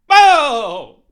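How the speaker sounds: loud startled whoa; shocked whoa. Retold or imitated. Comic retelling the nurse call, loud shocked whoa. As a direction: blurt it out in disbelief.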